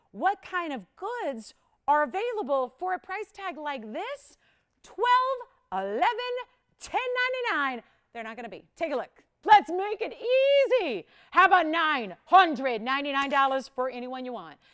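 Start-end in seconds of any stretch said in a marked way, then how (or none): 0.1-7.8 high, drawn-out build to loud punched numbers; excited price reveal. Real, not acted. Host teases viewers with the price drop, excitedly building. 9.4-14.6 loud, high burst settling into a loud pitch; big price announcement. Real, not acted. Host reveals the final price to callers, loud and excited.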